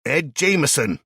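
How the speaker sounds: loud, high shouted name. A narrator's reading. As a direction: yell the name from outside. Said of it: "Someone else shouts".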